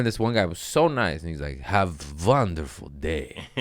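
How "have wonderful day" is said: put-on slow, heavy Russian accent, sweet but menacing; deliberate Russian-accented well-wishing. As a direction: say it like a kind Russian who sounds like a death threat. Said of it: "sweet Russian person trying to sound nice in English".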